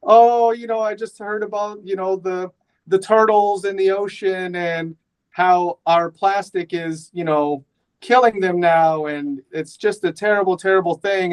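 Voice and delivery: high-pitched, hammed-up tearful lament; mock sobbing. Wife tells husband about turtles and plastic, mock sobbing. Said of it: "she's sobbing and she's crying".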